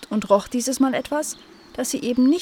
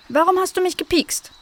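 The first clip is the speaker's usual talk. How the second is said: high, indignant little voice; offended; 10 semitones higher, 4 dB louder than usual. A narrator's reading. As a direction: demand an explanation, pitched high and hurt. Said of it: "Cleo war noch immer beleidigt". (hoch und beleidigt)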